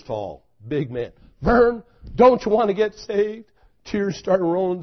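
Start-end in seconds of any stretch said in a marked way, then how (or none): 1.4-3.5 raised, pleading call to a friend; urgent plea. Retold or imitated. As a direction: press him to get saved, loud and earnest. Preacher pleading with man at church.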